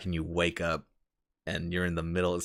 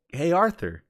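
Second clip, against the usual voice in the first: high-pitched, louder camp-mate voice; Bill's greeting; 11 semitones higher, 5 dB louder than usual. Retold or imitated. Bill greeting Arthur back at camp, high call. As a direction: call out in a raised character voice. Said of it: "Bill comes up. He's like".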